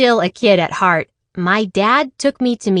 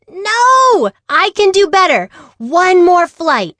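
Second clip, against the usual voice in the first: much higher, louder begging for more; pleading protest; 10 semitones higher, 4 dB louder than usual. Acted. Amelia begs her instructor for one more flight, high and loud.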